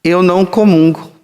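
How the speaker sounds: quoting a parishioner. Retold or imitated. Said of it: "ela diz assim".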